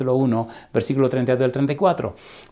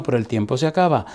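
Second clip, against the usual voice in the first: usual speaking voice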